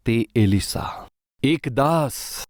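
slow, low and weighty; slow solemn emphasis. Acted. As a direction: let each word sink in slowly. Preacher names humble servant.

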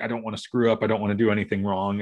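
usual speaking voice

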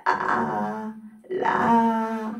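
vocal fry on あー; edge voice. Shown as a demonstration. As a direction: creak into the start of the vowel. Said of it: "エッジボイスね".

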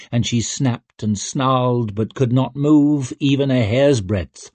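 usual speaking voice

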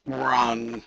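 ordinary delivery

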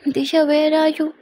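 drawn-out call toward the shower. Acted. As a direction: call her name from outside the door. Calling out to Disha in bathroom.

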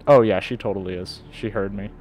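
ordinary delivery

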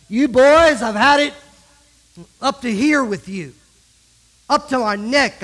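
put-on a fed-up mom yelling at her boys; exasperated mother. Mother scolding her two boys, loud exasperated yell.

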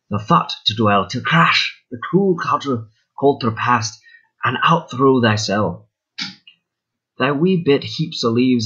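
measured poetry recital in a Scottish brogue; old Scots verse, amateur Scottish accent; fake Scottish brogue. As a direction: recite it in your best put-on Scottish accent. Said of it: "In my best Scottish brogue".

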